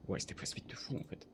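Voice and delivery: breathy low whisper. Real, not acted. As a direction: whisper so nobody hears. Chuchote en jeu. (chuchoté)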